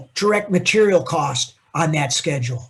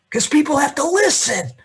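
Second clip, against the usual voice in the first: louder; 9 semitones higher, 4 dB louder than usual. Shown as a demonstration. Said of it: "You know why people whisper?".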